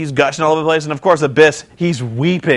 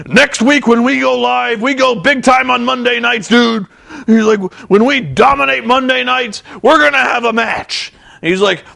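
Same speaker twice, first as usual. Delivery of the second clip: Hogan promo; 6 semitones higher than usual. Retold or imitated. Hogan announcing Monday night match, mock promo. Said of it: "And he's like".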